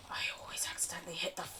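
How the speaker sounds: whispered under the breath. Real, not acted. Streamer whispering her own mistake. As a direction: hush it to yourself. Muttering while playing.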